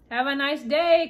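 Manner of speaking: loud, high friendly call. Acted. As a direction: call up after him cheerily. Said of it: "Cora called".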